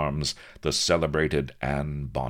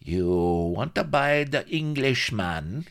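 high, slow foreign-villain croak; 10 semitones higher, 3 dB louder, slower than usual. A narrator's reading. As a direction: croak it out slowly, pitched high. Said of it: "he croaked".

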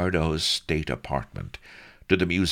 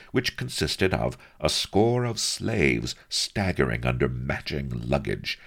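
ordinary delivery, nothing marked

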